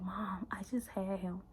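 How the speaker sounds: soft whispered disbelief; hushed, in shock; stunned whisper. Retold or imitated. Telling her mom the birth.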